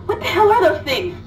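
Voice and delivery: shocked. Acted. Shocked at the creatures.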